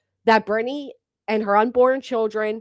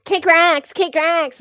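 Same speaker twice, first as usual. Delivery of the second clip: higher, louder sneering repeat; mocking loud echo; 7 semitones higher, 6 dB louder, much slower than usual. Vlogger mocking Reality's catchphrase, loud and high. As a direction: throw her catchphrase back at her loudly.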